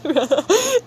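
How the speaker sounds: high-pitched frustrated laugh. Real, not acted. Streamer struggling with a drawing, laughing.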